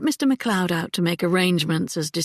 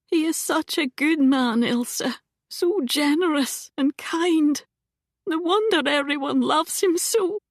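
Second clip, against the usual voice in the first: aunt on the edge of sobbing, higher; tearful; 8 semitones higher than usual. A narrator's reading. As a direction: hold back tears as she speaks of him. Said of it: "She broke off with a sob".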